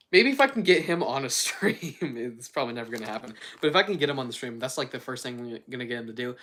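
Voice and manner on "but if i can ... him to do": ordinary delivery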